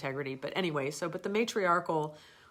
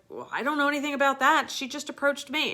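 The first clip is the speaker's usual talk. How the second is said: defensive reply; 10 semitones higher, 8 dB louder than usual. Retold or imitated. Her reply to trainer.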